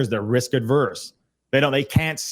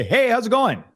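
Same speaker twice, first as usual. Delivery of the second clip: cheerful greeting; 10 semitones higher than usual. Retold or imitated. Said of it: "you say".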